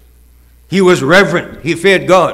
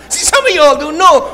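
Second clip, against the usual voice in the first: laughing through the words, pitch much higher; 11 semitones higher than usual. Real, not acted. Preacher teasing his congregation, laughing. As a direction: chuckle as you tease the congregation.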